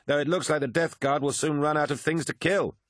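high-pitched, cocky battle report; swaggering swordsman Lucius, boastful. Acted. Swordsman reports the captured palace to his comrade, boastful. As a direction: boast of your kills in a lifted voice.

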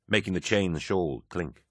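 ordinary delivery

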